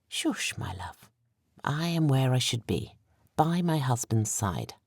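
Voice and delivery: soft, hushed and tender; tender whisper. A narrator's reading. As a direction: whisper it gently to him. Wife whispers tenderly to husband.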